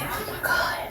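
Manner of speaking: whispered oh my God. Retold or imitated. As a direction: breathe it out in a shocked whisper. Shocked aside about stranded man.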